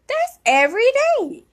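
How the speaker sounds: high, loud disbelieving exclamation. Retold or imitated. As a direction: shoot it up in shocked disbelief. Reacting in disbelief to doctor's advice.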